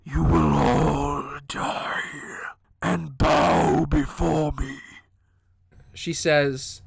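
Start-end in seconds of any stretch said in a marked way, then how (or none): 0.1-4.8 slow, dark menacing whisper. Acted. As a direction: intone it slowly, like a dark god. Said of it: "A dark voice ... whispers".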